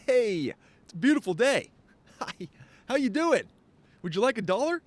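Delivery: overly cheery greeter. Retold or imitated. Imitating his cheery morning self greeting strangers, sing-song high. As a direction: beam at every stranger you pass. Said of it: "me just walking around like".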